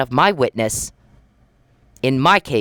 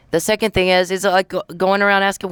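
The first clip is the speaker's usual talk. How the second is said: normal talking voice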